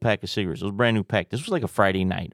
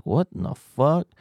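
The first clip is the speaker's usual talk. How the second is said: higher, stunned "what in the fuck"; amazed double take; incredulous; 7 semitones higher than usual. Retold or imitated. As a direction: pitch it up in disbelief. Baffled inner reaction.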